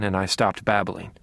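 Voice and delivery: ordinary delivery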